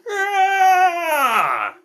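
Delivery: high, loud, long battle roar. A narrator's reading. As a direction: charge with a huge roar. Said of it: "He went".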